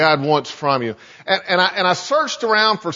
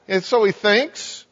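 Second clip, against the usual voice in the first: normal talking voice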